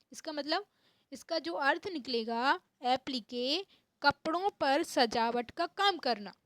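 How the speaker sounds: ordinary talk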